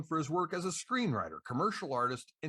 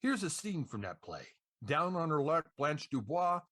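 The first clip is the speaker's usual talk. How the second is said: ordinary delivery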